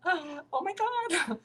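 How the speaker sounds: very high, slow, breathless "oh my God"; stunned gasp. Real, not acted. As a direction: let it out on a gasp, drawn out and high. Gasps at the man's grief.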